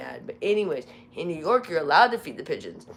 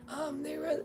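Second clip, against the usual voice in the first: voice cracking; 11 semitones higher, 7 dB quieter than usual. Real, not acted. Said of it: "See, it still cracks sometimes".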